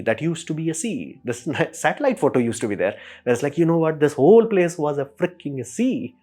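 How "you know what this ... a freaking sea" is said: raised, slowed mock outburst; amazed exclamation. Imitating people discovering the lost sea, raised amazed voice. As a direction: play it as stunned outrage.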